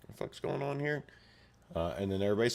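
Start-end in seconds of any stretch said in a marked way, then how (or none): 0.1-1.0 soft, high little fairy voice; whisper-squeak of confusion; tiny fairy baffled by the carnage. Retold or imitated. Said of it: "the fairy's all like".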